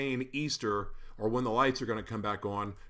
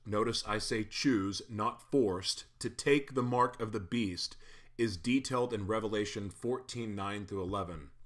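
ordinary delivery

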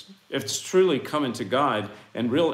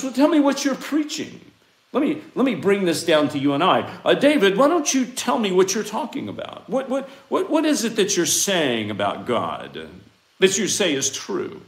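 questioning high priest; 7 semitones higher, 4 dB louder than usual. Retold or imitated. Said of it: "asked Jesus".

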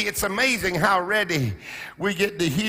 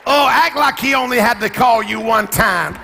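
loud, high shouted preaching; full-force preaching; 3 semitones higher, 4 dB louder than usual. Acted. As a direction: raise the roof with the sermon.

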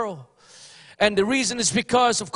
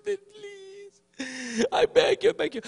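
high, pleading "please" repeated, voice pinched and rising; whiny begging prayer with a near sob; mock begging prayer; 10 semitones higher, slower than usual. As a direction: ham up a desperate beggar before God. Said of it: "the way they pray".

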